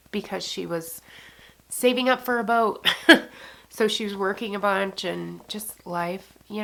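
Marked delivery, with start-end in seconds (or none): 2.9-3.5 a short, loud, rueful laugh through tears; real laugh that breaks through sadness. Real, not acted. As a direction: laugh it off mid-cry.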